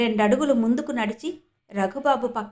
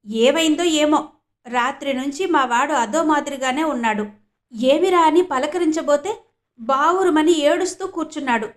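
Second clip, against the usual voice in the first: a mother's voice raised higher and louder, fretting; worried mother; 4 semitones higher, 5 dB louder than usual. Acted. Annapurnamma tells Savitri her son is crying, worried and louder. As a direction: fret aloud to her sister-in-law about her son.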